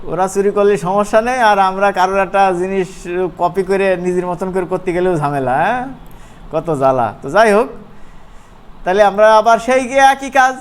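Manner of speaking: usual speaking voice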